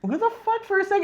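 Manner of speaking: high, startled question; mock baffled. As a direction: fans reacting to the voice, high and baffled. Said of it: "you're just all like".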